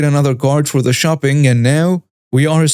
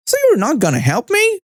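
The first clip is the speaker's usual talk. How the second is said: much higher, indignant entitled customer; mock Karen; 11 semitones higher than usual. Retelling Karen demanding her help, high indignant mock whine. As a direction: play the offended Karen, pitch way up. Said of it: "Then she starts".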